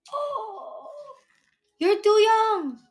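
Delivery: high, surprised exclamation. Real, not acted. Wife surprised the girl works so young, high exclamation.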